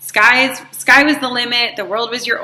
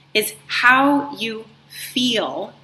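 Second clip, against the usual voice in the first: drawn-out feel; much slower than usual. Real, not acted. Coach lingering on feel for her listeners, drawn out. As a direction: stretch the word feel slowly. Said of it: "I emphasize that word in that way".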